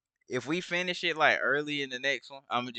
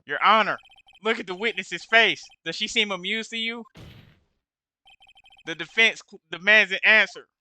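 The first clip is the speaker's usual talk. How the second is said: louder, higher courtroom push; forceful objection; 5 semitones higher, 7 dB louder than usual. Acted. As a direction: demand it across the courtroom. Defense attorney pressing in court.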